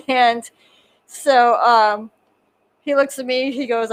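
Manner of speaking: usual speaking voice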